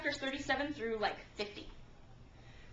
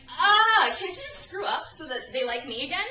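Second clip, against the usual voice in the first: high, loud whine with a laugh through it; loud mock complaint, laughing; mock whiny jealousy; 6 semitones higher, 7 dB louder than usual. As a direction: play the jealous sibling, half joking. Jealous of favored sister.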